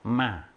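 마 low, dropping firmly; short 마 that falls with force; forceful falling tone. Shown as a demonstration. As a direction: demonstrate Vietnamese 마 pushed downward. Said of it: "힘있게 내려".

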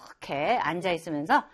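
ordinary talk

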